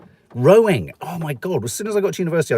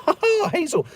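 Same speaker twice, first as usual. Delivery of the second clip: laughing. Real, not acted. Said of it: "laughing at listener".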